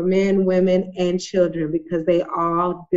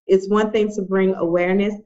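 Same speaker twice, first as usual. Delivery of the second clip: usual speaking voice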